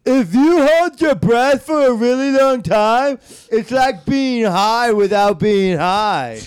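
very high, loud, drawn-out dopey voice; loud, slow cartoon dimwit; dim-witted shoulder devil. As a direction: blare it slowly like a gleeful idiot.

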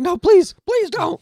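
high, loud pleading cry; desperate begging. Retold or imitated. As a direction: beg them to stop. Downed player begging for mercy.

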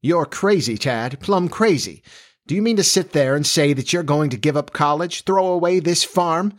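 indignant mother, high-pitched; exasperated outburst, pitch well up; bursting out. A narrator's reading. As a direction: scold your son in disbelief. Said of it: "Florence burst out finally".

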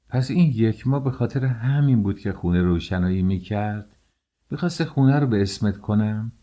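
ordinary delivery

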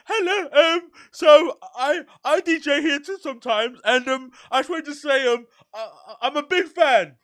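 high, hesitant starstruck gush; nervous fan voice. Retold or imitated. Fan telling Harry he DJs too, high nervous gushing. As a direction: stammer up at your idol, high.

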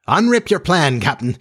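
high, excited urging; eager cry. A narrator's reading. Smee urges the captain to reveal his plan, crying eagerly. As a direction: burst out high, impatient to hear it. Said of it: "he cried eagerly".

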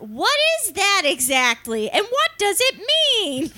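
high, puzzled newcomer voice; high clueless voice. Retold or imitated. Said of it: "they look directly at the camera and they go".

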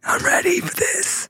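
high, slow, breathy line; cool new voice. Acted. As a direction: play the new cool hero. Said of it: "He even sounds different".

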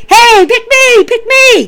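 high, squeaky, eager begging; eager pick me. Retold or imitated. Leon Ruff begging Karrion Kross to pick him, high and eager. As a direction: beg to be picked like an overeager kid. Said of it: "running up to Karrion Kross and going".

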